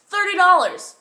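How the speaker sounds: high, loud price call; street vendor. Acted. Vendor naming his price to a shopper, loudly.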